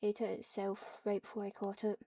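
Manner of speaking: ordinary delivery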